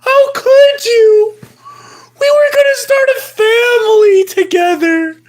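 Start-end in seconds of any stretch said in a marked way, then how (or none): none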